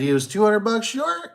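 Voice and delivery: high-pitched incredulous question; retold disbelief. Seller hearing the dealer's story, retold incredulous surprise.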